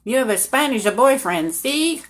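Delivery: loud, lifted mock-official voice; imitated border officials. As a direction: put on the officials' knowing tease. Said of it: "they'd look at me and they'd say".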